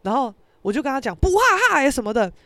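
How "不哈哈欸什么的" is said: higher, louder mock complaint; retold anger. Telling boxing friend about it, indignant. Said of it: "我也很气哦".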